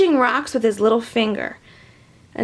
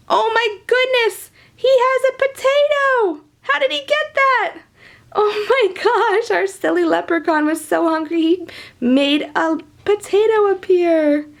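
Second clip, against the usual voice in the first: much higher, amazed exclamations; hammed-up astonishment; mock amazement; 8 semitones higher than usual. Host amazed the toy leprechaun has a potato.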